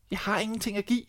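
put-on high sad girl's voice; a touch of sorrow, high; sorrowful girl. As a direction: say it sadly as the young girl. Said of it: "svarede pigen bedrøvet".

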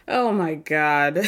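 slow, lightly imitated exasperation; exasperated eye-roll. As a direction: drag it out, mildly fed up. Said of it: "Just rolls her eyes".

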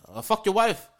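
high-pitched imitation of an Irish accent; mock Irish accent. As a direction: taunt in a jokey Irish accent. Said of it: "in his Irish accent".

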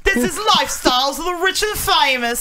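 high TV-host impression with British accent; Robin Leach. Shown as a demonstration. Co-host doing requested impression, high and declaiming. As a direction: proclaim it like a grand British presenter. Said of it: "do Robin Leach for us".

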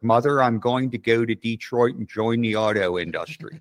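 put-on John Waters movie Baltimore accent; imitated Baltimore Tidewater accent. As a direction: mimic a thick Baltimore accent. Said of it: "those old John Waters movies".

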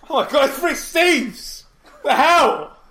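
a laughing, high, loud "Oh my God, it's Rick Steves!"; excited shout. Acted. Co-host playing along, starstruck at meeting Rick Steves, in a laughing shout. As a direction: shriek it out with mock starstruck glee, stretched slowly.

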